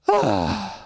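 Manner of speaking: fed-up sigh; exasperated sigh. Real, not acted. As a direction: let out an exasperated breath. Fed up after the impression.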